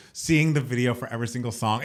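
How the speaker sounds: ordinary delivery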